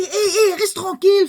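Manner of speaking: high, loud telling-off with a laugh; rebuking. Acted. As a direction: hush him sharply, laughing. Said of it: "le rabrouèrent pour le faire taire".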